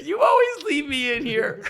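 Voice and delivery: high, loud, whining pet; the dog complains, crying out; whiny dog voice. Pretend.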